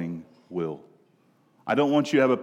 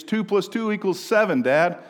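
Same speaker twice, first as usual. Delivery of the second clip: put-on child's voice; 7 semitones higher than usual. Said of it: "My kids come and show me math pages".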